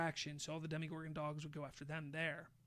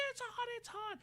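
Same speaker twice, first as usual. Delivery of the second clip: very high mock yelping; freaking out. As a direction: imitate someone frantic from the heat. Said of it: "he's freaking out".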